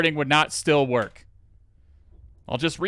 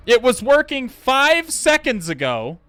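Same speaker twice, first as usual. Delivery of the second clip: high, loud exasperated complaining; venting real frustration at a broken setup; frustrated rant; 7 semitones higher, 5 dB louder, slower than usual. Real, not acted. Streamer fuming to chat about the broken lab, loud and exasperated.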